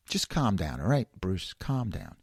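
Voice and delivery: quiet self-reassurance; calming himself. Real, not acted. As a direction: talk yourself down quietly. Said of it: "talks himself down".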